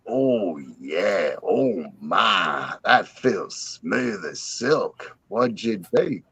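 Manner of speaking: low, soft, slow groaning pleasure; blissed-out john. Retold or imitated. John with the hooker, low and soft in bliss. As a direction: sink low and melt into it.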